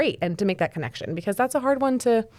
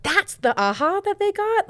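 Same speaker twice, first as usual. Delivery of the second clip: surprised; 10 semitones higher, slower than usual. Retold or imitated. Surprised quote of a client.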